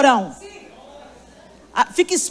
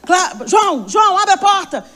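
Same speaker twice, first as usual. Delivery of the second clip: higher, louder call through a door; urgent call; 6 semitones higher, 3 dB louder than usual. Retold or imitated. Grandfather banging on the bedroom door, urgent raised call. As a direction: demand the door open now.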